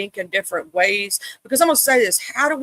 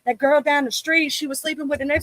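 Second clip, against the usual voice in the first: boisterous room greeter; 8 semitones higher than usual. Retold or imitated. Doing herself walking into a room, a hammed-up boisterous greeting. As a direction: burst in like the loudest person in the room. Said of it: "We like".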